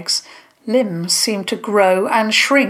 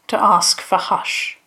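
usual speaking voice

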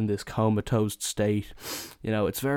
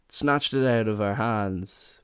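usual speaking voice

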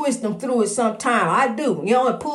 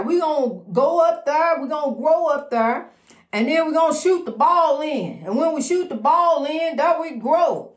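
raised pitch, rousing sermon cadence; preaching; 4 semitones higher than usual. Acted. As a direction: lift the crowd like a preacher. Uplifting preaching to followers.

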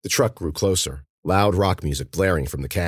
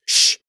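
sharp, loud "shh"; urgent shush; 6 dB louder than usual. A narrator's reading. Cece silencing friends as the truck pulls up, urgent shush. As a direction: hush them hard.

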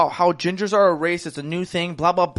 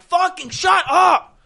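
high, drawn-out exasperated yell; slow, strained shout of frustration; retold angry shout; 8 semitones higher, much slower than usual. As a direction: act out snapping at the taunters. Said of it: "And I was like".

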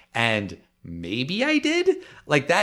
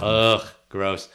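disgusted groan at the news; grossed-out ugh, drawn out; annoyed ugh. Real, not acted. Groaning at Spider-Man being number one.

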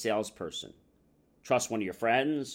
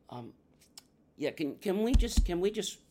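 higher, stumbling, repeated interruption; uncomfortable customer; 6 semitones higher than usual. Acted. Customer escaping the salesman's prying, awkward and halting. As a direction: cut off the questions haltingly, pitch raised.

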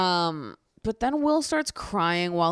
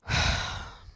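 long audible exhale; heavy sigh. Real, not acted. Recapper before the painful entrance, sighing. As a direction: let out a sigh.

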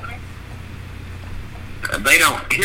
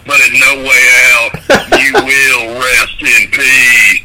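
Undertaker impression; 3 semitones higher, 6 dB louder, slower than usual. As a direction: drawl out rest in peace. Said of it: "really good Undertaker impression".

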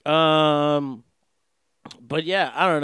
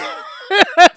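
high, louder burst of laughter; 14 semitones higher, 8 dB louder than usual. Real, not acted. Laughing at triple taxes.

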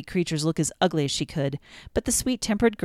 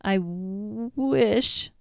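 sobbing; 4 semitones higher, much slower than usual. A narrator's reading. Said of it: "sobbed poor Cinderella".

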